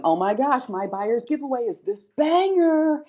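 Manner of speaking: high, drawn-out excited hype; hyped seller. Acted. Seller showing viewers the giveaway prize, hyped. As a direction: gush over the prize, stretching it out.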